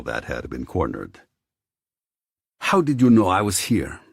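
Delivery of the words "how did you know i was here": angry demand. Acted. Captive demands answers.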